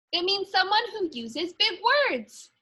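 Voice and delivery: a hint of a confident young girl; Ruby answering. A narrator's reading. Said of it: "Ruby answers the principal".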